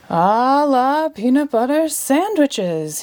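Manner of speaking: slow, drawn-out spell; slowly, with great care. A narrator's reading. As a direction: stretch out every word. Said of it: "he said slowly".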